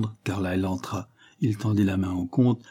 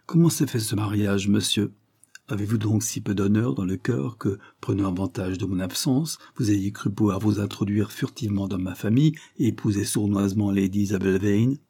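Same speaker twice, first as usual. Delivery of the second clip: ordinary delivery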